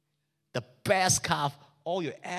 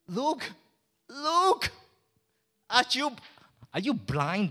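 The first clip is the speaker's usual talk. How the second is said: high, rising, indignant; angry son; 8 semitones higher, slower than usual. Acted. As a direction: throw it at the father. Son confronts father angrily.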